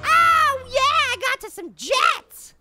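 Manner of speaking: loud, drawn-out whoop easing into slow crowing; triumphant yell. Real, not acted. Player getting jets, triumphant yell. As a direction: scream the first word, then savor the win.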